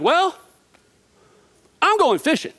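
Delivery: a shrug of a line from bored Peter; Peter announcing. Retold or imitated. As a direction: toss it off like a restless Peter. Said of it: "and Peter's like".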